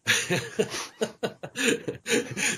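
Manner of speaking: real high, sustained laughing fit. Real, not acted. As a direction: crack up at your own dirty joke. Cracking up at the retold joke.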